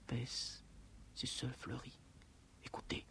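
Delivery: breathy hushed words, barely voiced; whispered recitation. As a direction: let the lines die into a whisper. Poem recited sinking to whisper. (recitazione sussurrata)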